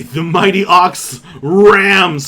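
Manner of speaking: loud, slow, heroic narration; big game master build-up to an attack; dramatic announcement. Acted. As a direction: draw out the charge with gusto. Game master announces the ox's charge.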